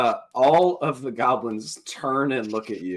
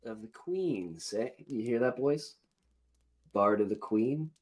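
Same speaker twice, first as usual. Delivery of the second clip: lightly voiced goblin, softer; goblin to his gang; 6 dB quieter than usual. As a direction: turn the title over for the boys. Goblin repeats title to his fellows.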